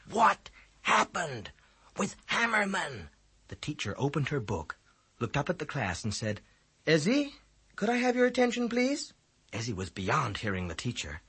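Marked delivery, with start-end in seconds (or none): 0.1-3.1 each word hit, slow and loud; pouncing on each word. A narrator's reading. Boy pressing his friend for news, each word punched. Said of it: "pouncing on each word". 6.9-9.1 high, firm teacher calling a pupil; a teacher pulling a daydreamer back; teacher's voice. A narrator's reading. Teacher calls a distracted boy to attention, firm and high.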